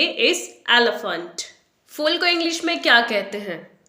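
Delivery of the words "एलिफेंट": एलिफेंट as EL-uh-funt; no ee, no "fent" in एलिफेंट, just schwas; correct pronunciation. Shown as a demonstration. Teacher correcting learners, एलिफेंट as EL-uh-funt. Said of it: "फेंट भी नहीं बोलेंगे".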